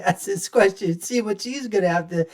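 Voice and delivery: ordinary talk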